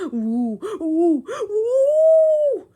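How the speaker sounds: rising high, loud ghostly howl; howling wind woo. Acted. Wind sound effect.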